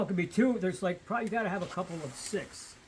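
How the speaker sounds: normal talking voice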